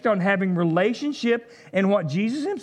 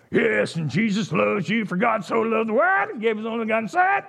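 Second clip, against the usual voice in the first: mock street preaching; 2 semitones higher than usual. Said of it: "I didn't get to say".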